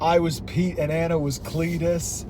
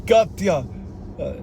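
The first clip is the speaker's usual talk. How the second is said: higher-pitched mock Russian accent, halting; 3 semitones higher than usual. Shown as a demonstration. Astronaut asking Katya for vodka, Russian accent. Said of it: "Russian astronauts".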